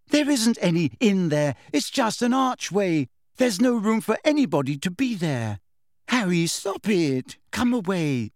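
high, sharp, overwrought; angry. A narrator's reading. Hermione scolding Harry to leave the archway, angry. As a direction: snap at him, too angry for the moment. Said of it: "sounding much angrier than the occasion".

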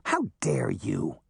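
slow, affronted retort; indignant. A narrator's reading. Boy insulted by Daphne's advice, slow indignation. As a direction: draw it out in offended dignity. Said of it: "ignoring his indignation".

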